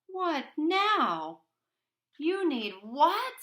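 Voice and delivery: high, loud exasperated questions; fed up, disbelieving. Acted. Boy exasperated.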